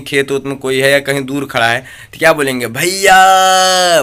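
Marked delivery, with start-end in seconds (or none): 2.7-4.0 "भैया" called out higher and louder, held very long. Shown as a demonstration. Teacher calls "भैया" across a field to show pluta. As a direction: call "भैया" to someone far away in a field, stretching it out. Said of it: "बहुत तेज़ी से बोलेंगे".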